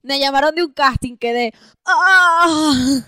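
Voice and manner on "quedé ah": drawn-out pleasure cry, very slow; mock ecstatic moan. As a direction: fake a loud moan of delight. Mock ecstasy at good news.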